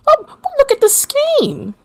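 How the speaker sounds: very high, thin falsetto voice; arguing woman. Acted. Third of three voices cutting into the squabble, arguing. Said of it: "you hear three voices go".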